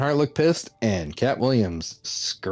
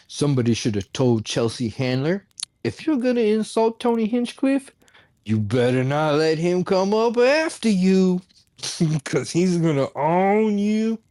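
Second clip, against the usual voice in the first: mock article voice; 7 semitones higher than usual. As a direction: sneer it out like a smug critic. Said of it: "they're like".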